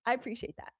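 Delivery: ordinary delivery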